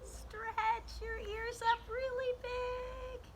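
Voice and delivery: high, slow, drawn-out words; stretched out high. Shown as a demonstration. Teacher guiding kids into the stretch, high and slow. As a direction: pull each word up like long bunny ears.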